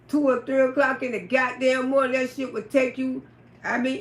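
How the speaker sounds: ordinary talk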